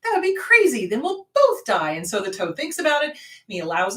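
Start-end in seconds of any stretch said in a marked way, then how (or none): 0.0-1.9 high, loud mock-innocent protest; wheedling scorpion. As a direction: play the scorpion acting harmless. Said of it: "the scorpion goes".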